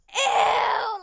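loud, high screamed imitation; mock disgusted scream. Teacher catches a student's kiss, screamed disgust. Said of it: "screamed, you know?".

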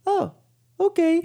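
high squeaky oh okay. Retold or imitated. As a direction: squeak it out, surprised. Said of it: "Did you use that same squeaky voice".

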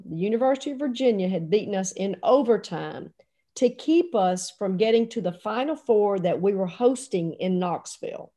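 ordinary delivery

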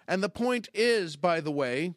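ordinary talk, nothing marked

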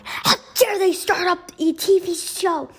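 angry shout. A narrator's reading. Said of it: "started shouting".

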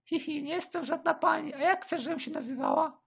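high-pitched giggling woman; high flirty woman. Acted. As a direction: giggle and tease him. Escort giggling.

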